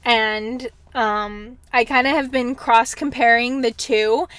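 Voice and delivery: ordinary talk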